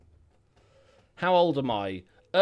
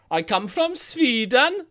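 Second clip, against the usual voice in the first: put-on loud, high, slow Swedish accent; mock Swedish accent; 11 semitones higher, 8 dB louder, slower than usual. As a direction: belt out a comic Swedish accent, drawn out. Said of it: "Just gonna sit there doing accents".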